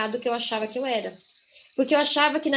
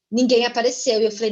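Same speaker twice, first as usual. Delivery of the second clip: ordinary talk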